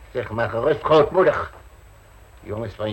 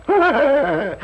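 loud burst of laughter; 13 semitones higher, 6 dB louder than usual. Acted. Scoffing at nephew's claim of love.

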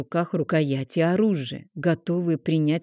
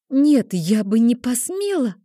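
desperate pleading; 6 semitones higher, 4 dB louder than usual. A narrator's reading. Desperate denial.

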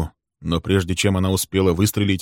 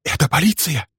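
high-pitched, hushed alarm; scared, high stage whisper; frightened whisper; 9 semitones higher than usual. A narrator's reading. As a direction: gasp it out fearfully under your breath. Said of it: "шепнула она испуганно".

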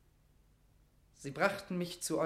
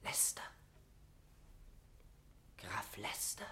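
whispered, incredulous echo; aghast whispered question; stunned disbelief; 5 semitones lower, 12 dB quieter than usual. Acted. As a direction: repeat the name in shocked hush. Mortimer aghast at the name.

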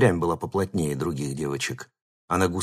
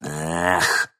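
disappointed sigh; 3 semitones lower than usual. A narrator's reading. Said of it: "расстроенно махнул рукой".

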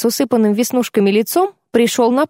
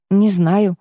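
ordinary delivery

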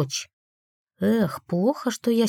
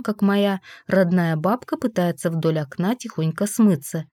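plain reading voice

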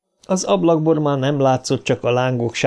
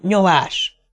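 higher, short urgent command; restrained yell; 5 semitones higher than usual. A narrator's reading. Trev dragging his friend along the wall, yelling. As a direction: urge them on without full volume. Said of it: "üvölti Trev".

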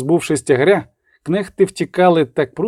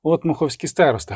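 ordinary delivery, nothing marked

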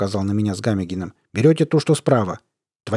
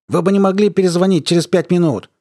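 raised, higher voice over a bad line; to someone hard of hearing; 7 semitones higher, 4 dB louder than usual. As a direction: call out as if the line is breaking up.

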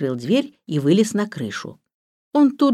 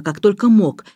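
usual speaking voice

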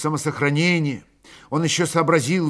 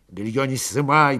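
ordinary delivery

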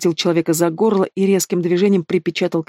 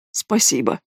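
hoarse croak; 4 semitones lower, slower than usual. Acted. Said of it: "прохрипел человек".

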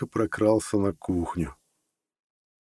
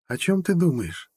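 put-on curious girlfriend; 9 semitones higher than usual. A narrator's reading. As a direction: ask it lingeringly in a woman's higher voice. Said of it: "спросила она наконец". (высокий женский голос, неторопливый вопрос)

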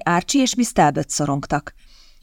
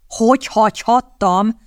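suppressed anger; 4 semitones higher, slower than usual. A narrator's reading. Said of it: "igyekezett elfojtani a mérgét".

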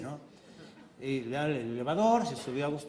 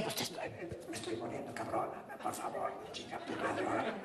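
softer airy whisper; dying whisper; 4 semitones higher, 10 dB quieter than usual. Acted. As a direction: breathe the words like dying. Wife faking dying, whispering.

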